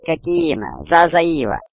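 small creature babbling in its own tongue; little creature voice. A narrator's reading. Little one speaks to Jack in his language, creature voice. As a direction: strange words leaping up into a high pipe. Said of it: "Малыш говорил".

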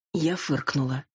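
ordinary delivery

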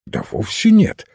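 lively, mildly animated retort; lively reply. A narrator's reading. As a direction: answer briskly, pitch lifting. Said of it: "живо отозвался Финц".

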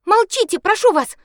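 high, loud pleading cry; sharp shout. Acted. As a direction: snap at her to be silent. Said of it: "она прикрикнула на неё".

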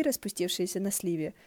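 ordinary delivery

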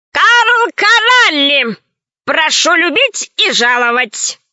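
grand, slow showman's announcement; old bandit presenting his captive to the guests with a flourish; exclaiming. A narrator's reading. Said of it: "воскликнул старый разбойник".

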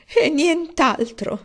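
high, very slow plaintive cry; tearful. A narrator's reading. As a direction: drag it out in misery. Mother's tearful addition. (mamma che aggiunge piangendo)

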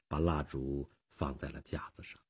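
plain reading voice

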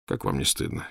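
doctor shaming the intruders; hushed reproach. A narrator's reading. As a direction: scold in a breathy hush. Doctor scolds.

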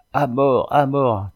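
rallying mob yell; crowd crying out. A narrator's reading. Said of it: "crient tous les marinacs".

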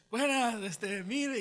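high, loud, hesitant hail; acted calling out. Walking beside the moving car, calling out politely. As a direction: try to catch a driver's attention. Calls to driver while walking alongside.

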